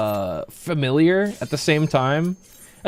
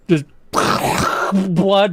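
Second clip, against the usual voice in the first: ordinary talk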